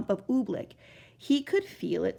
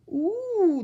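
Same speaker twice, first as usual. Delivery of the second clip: fretful ooh; 10 semitones higher, 4 dB louder than usual. Acted. Bell ringer wrings hands.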